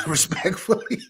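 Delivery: high, through a laugh. Real, not acted. Podcast panelist softening his heated objection, laughing. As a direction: let the word break into laughter.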